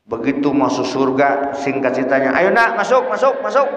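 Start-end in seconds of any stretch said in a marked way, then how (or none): 2.4-3.6 high, brisk urging call; angels urging child in. Acted. Angels inviting a child into heaven, urging. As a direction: usher the child in with a raised voice. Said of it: "Kata para malaikat".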